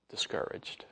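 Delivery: the word breathed out in a whisper. Real, not acted. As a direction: drop to a whisper for the heavy word. Paul's low mood, told to the congregation.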